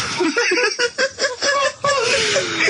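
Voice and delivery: high squealing laugh at his own story; high burst of laughter. Real, not acted. Laughing hard at his own story to friends, high squealing laugh. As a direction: break into a high, helpless laugh.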